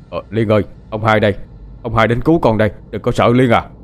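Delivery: calling out. Acted. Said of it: "vừa đi vừa gọi to tên con Liên".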